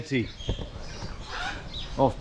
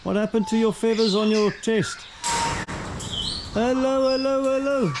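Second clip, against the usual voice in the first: cooing at a bird; 7 semitones higher than usual. Real, not acted. Talking to a bird.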